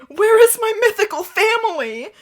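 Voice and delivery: high, loud dramatic wail; distressed demand. A narrator's reading. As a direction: cry it out in mock desperation. Victim's desperate cry to captor.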